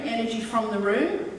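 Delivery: ordinary delivery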